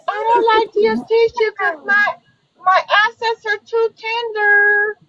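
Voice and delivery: whiny and delicate. Retold or imitated.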